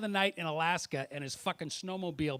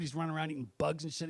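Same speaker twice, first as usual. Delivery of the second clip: ordinary talk, nothing marked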